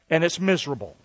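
normal talking voice

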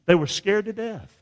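ordinary delivery

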